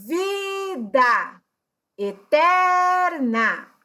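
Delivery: VIDA and ETERNA called out high, VI and TER held long; stressed syllables drawn out. Shown as a demonstration. Teacher reading examples to show the stressed syllable, VI-da and e-TER-na drawn out. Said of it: "Aí tem o exemplo".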